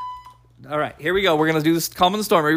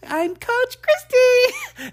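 much higher, slow falsetto coach voice; mock Coach Christy; much slower than usual. Host imitating his co-host, high, slow falsetto. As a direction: squeak out the name like a bubbly coach. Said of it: "[name]".

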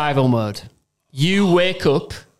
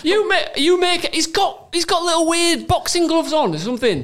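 appalled; 11 semitones higher than usual. Real, not acted. Disgusted describing creepy baby.

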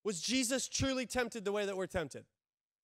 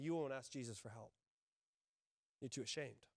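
much softer, lower; hushed; 8 semitones lower, 12 dB quieter than usual. Real, not acted. Pastor gently telling church shame keeps them away, much softer. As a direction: drop to a near hush. Tender point about shame.

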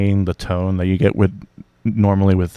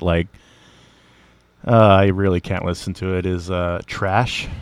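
usual speaking voice

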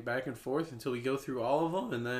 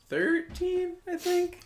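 pitched up, questioning; unsure rising; 5 dB louder, slower than usual. Real, not acted. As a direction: ask it like you're not sure. Unsure of episode number.

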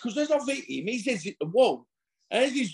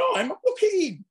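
indignant disbelief; 14 semitones higher, 4 dB louder than usual. Retold or imitated. To the insulting bouncer, high outraged disbelief. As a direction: pitch shoots up high in outrage. Indignant reply before the punch.

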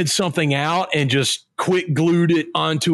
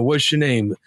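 low movie tough-guy voice; lightly voiced gruff burnout; Bruce Willis; 7 semitones lower than usual. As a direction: play it as a low-key growler. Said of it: "growls every time".

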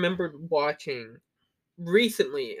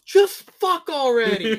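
loud, drawn-out heckle, higher pitched; imitated crowd yell; 8 semitones higher, 8 dB louder, slower than usual. Audience member heckling the film's ending, yelled. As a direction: yell it out like an impatient moviegoer. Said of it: "somebody in the crowd just yells".

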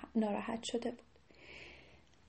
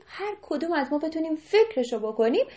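ordinary delivery, nothing marked

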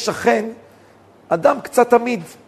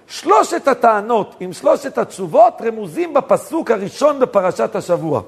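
ordinary delivery

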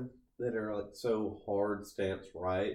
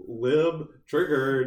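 loud, drawn-out mock taunt; mock right-wing guy; 3 semitones higher, 9 dB louder, much slower than usual. Joker taunting a lib in a mock right-wing guy voice. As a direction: crow it slowly like a parody heckler. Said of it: "twenty sixteen right-wing guy".

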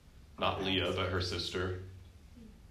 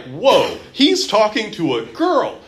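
mock shocked disciples; 11 semitones higher, 7 dB louder, slower than usual. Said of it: "So why are they so like".

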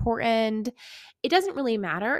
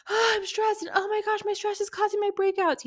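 high, fretful mock panic, lightly played; a client spiralling, higher and worried; mock panicky client; 11 semitones higher than usual. Client panicking over stress, high mock fretting. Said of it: "where they're like".

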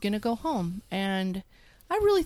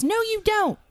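high, bossy mother voice; mocking high-pitched mom voice; imitating mom; 12 semitones higher, 5 dB louder than usual. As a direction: mimic mom ordering her daughter around. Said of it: "She goes".

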